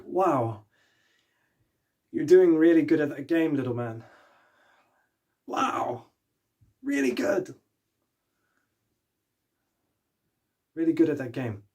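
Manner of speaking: lightly acted admiring praise, pitch lifting on "really good"; impressed stranger. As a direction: play a stranger marvelling at a little kid.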